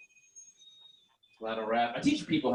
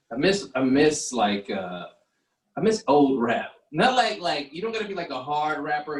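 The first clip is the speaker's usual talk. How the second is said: ordinary talk, nothing marked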